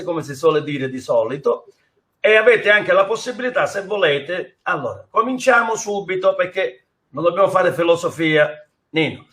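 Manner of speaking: ordinary delivery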